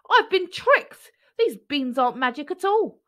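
high, loud, slowed angry spit; spat out angrily. A narrator's reading. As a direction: spit the words in fury. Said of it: "spat Jack".

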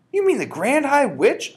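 high, loud startled cry; the boy gasps out the name; cried out. Acted. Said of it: "I cried".

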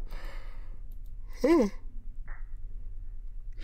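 drawn-out breathy sigh, trailing down; long weary sigh. Real, not acted. Tired streamer sighs at chat before yawning.

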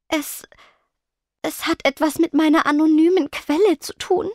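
halting, embarrassed start; stammering. A narrator's reading. Spectra confessing to friends, embarrassed stutter. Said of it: "stotterte Spectra verlegen".

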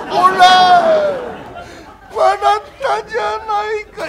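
high, slow whining complaint; hurt reproach. Acted. Young master complains that Kuma laughed, whining. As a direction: whine like a hurt sick boy.

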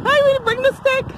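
high sing-out to a dog; high-pitched doggy talk; playful pet command. Real, not acted. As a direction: call the dog back in a squeaky voice. Calling dog to bring stick.

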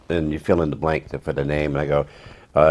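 ordinary delivery

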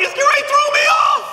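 high scared shout; panicked yell. Retold or imitated. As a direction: yelp it in panic. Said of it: "And I was like".

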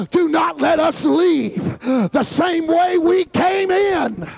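shouted, rising preacher cadence; preaching at full force. Acted. Preacher urging the congregation to pray, full-force preaching. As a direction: preach hard, calling the crowd to the altar. Preacher building to altar call.

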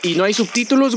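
ordinary talk

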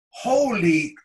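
high, loud cut-off exclamation; loud mock amazement; retold disbelief. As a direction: blurt it in astonishment, imitating the doctor. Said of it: "She could not believe".

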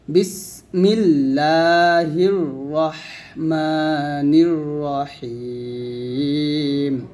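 slow, drawn-out recitation; measured chant. Shown as a demonstration. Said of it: "তাসমিয়াহ হচ্ছে".

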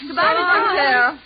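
high, loud farewell call; calling goodbye. Acted. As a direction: holler after someone leaving. Calling after departing driver.

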